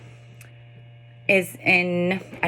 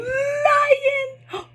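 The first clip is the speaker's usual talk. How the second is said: sharp high gasp of delight; high excited gasp. Shown as a demonstration. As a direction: gasp as if a toddler just spotted a lion. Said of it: "reading it this way".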